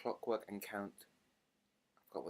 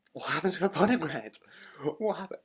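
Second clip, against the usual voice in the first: high, loud incredulous question; appalled and bewildered, pitched up; alarmed bafflement; 7 semitones higher, 7 dB louder than usual. Real, not acted. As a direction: ask it in comic horror. Baffled alarm at podcast rumor.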